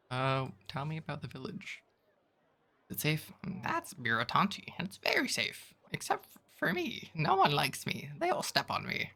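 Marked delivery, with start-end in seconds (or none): 3.6-9.1 much higher, chirpy little creature voice; tiny creature voice. Acted. Tiny creature Jan greets the traveler in a high squeak. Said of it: "I'm gonna stop this voice".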